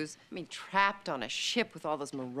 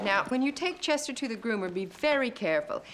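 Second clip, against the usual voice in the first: usual speaking voice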